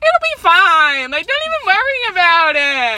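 hammed-up cheery optimist, voice way up; mock optimism. Friend imitating herself trying to be optimistic, hammed-up optimism. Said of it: "like, optimistic".

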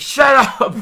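yelling at the kids in mock anger; loud, high snap of a stressed driver; hammed-up shout. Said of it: "He's like".

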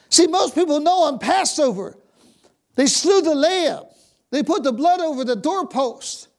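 high, loud preacher's build; full-force preaching. Acted. Pastor driving the point home to his congregation, in loud preaching. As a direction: preach it hard to the church.

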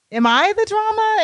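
squeaked up high in mock disbelief; mock shocked high. Shown as a demonstration. Asking Keith in mock shock, high squeak. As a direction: pitch it way up, stunned. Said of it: "Like, am I the drama?".

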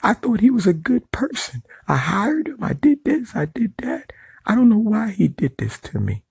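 high mocking whiny boss voice; mocking the smacked boss. Mocking the boss who called the police, high whiny voice.

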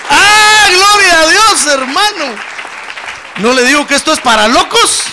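loud, high, jubilant cry of praise; full-force preaching. Real, not acted. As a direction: exult to the congregation at the top of your voice. (predicación exaltada, a gritos)

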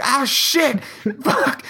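high, panicked swearing; retold panic. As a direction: squeal out the pain and panic.

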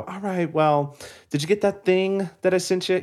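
imitated mom dragging out the call. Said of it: "say bye to my mom".

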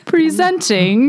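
slow, high, grand announcing; town crier. Pretend. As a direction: draw it out like a herald. Mock royal announcement.

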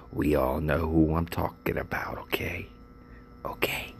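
whispered aside; hushed knowing aside; conspiratorial whisper. Real, not acted. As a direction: whisper it like a shared secret. Hinting at the unnamed coworker.

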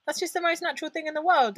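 high, disbelieving retold reaction; what are you talking about, disbelief; incredulous.

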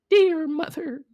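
put-on very high, loud squeaky kid voice; high cartoon child voice; little goat kids. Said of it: "the kid said".

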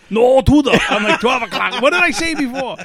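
loud, high mock exasperated yelling; hammed-up frustrated outburst; frantic exasperation. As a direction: argue back in comic panic, pitch climbing. Retold frantic arguing with hint-giver.

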